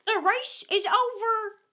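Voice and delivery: loud, high, drawn-out announcement; calling out. A narrator's reading. Dodo ending the race for the runners, calling out. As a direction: holler the news slowly. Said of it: "the Dodo suddenly called out".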